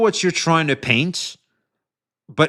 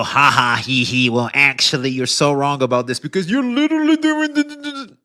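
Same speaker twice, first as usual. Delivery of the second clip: mocking a nitpicker. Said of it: "I could pull a Saint".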